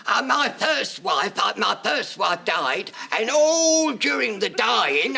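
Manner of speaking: high slow aged complainer; old man ranting. Retold or imitated. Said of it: "he said to Stanley".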